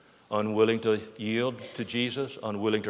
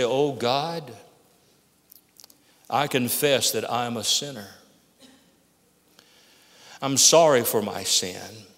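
guided prayer. Acted. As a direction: pray it humbly for the listener to repeat. Leads a sinner's prayer.

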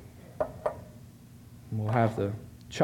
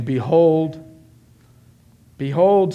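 loud, raised proclamation of "behold"; 4 semitones higher, 8 dB louder, much slower than usual. Acted. Voice from the throne to all, loud proclamation. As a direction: call it out from the throne. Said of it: "I heard a loud voice from the throne".

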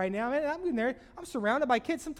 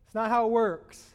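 preaching; 2 semitones higher, 7 dB louder than usual. Acted. Preacher to congregation, full-voiced preaching. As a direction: preach it out to the room. Fervent opening of the sermon.

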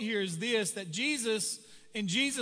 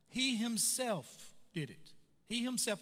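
slow, deliberate "He himself" with "himself" stressed; emphatic himself; 4 dB quieter, slower than usual. Shown as a demonstration. Preacher urging faith in Christ, stressing "himself". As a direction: drag out "He himself" to drive it home. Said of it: "it's emphatic".

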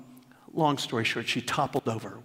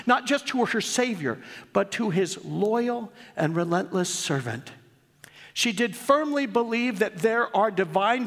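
reading aloud; 7 semitones higher than usual. Acted. Pastor reading his daughter's tribute to the church, raised storytelling read. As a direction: read it like a storyteller opening a tale.

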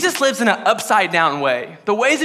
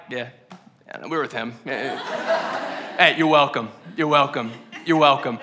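smug disciples; 10 semitones lower, 5 dB quieter than usual. Retold or imitated. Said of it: "the disciples are handing it out like".

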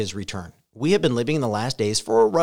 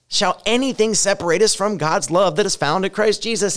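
louder, higher preaching of Romans; preaching scripture; 6 semitones higher, 5 dB louder than usual. Acted. Pastor reading Romans to a scared congregation, full-force preaching. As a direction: proclaim each line to the church.

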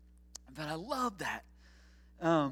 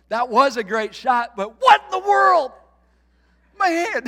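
loud, high exasperated outburst at the golfer; exasperated shout; 8 semitones higher, 12 dB louder than usual. Retold or imitated. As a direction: yell it in disbelief, much higher. Pastor retells outburst at golfer.

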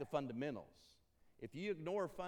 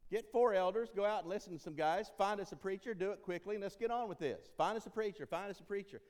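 higher, louder, pushing to hurry the search; impatient demand; 6 semitones higher, 5 dB louder than usual. Retold or imitated. Church members pushing the elders to hire fast, impatient. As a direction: rattle off the demands like someone who wants it done now. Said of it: "some of us here in the church are going".

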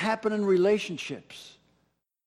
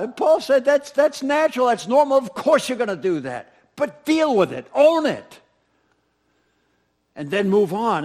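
higher, louder, driving sermon climax; preaching at full force; 6 semitones higher, 8 dB louder than usual. Acted. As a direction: drive it home to the congregation.

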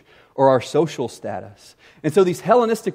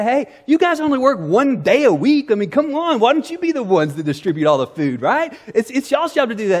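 mock whiny church member, higher and louder; nagging complainer; 7 semitones higher, 3 dB louder than usual. Church members grumbling at the apostles, mock whiny. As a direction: needle the apostles as lazy.

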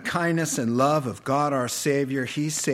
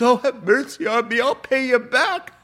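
higher, louder desperate begging; pleading cry; 10 semitones higher, 5 dB louder than usual. Retold or imitated. Debtor crying out to the king for mercy, high and loud. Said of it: "has just cries out and says".

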